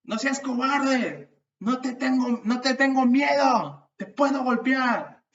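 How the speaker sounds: loud, high, slow taunting shout at a bully; provocative yell. Pretend. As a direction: yell out to pick a fight. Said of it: "imagínate que yo gritara". (grito provocador, alto y lento)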